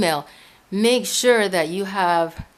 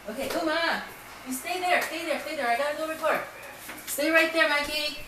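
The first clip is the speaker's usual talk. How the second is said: coaxing pets; 7 semitones higher, 7 dB quieter than usual. Real, not acted. Telling pets to stay put.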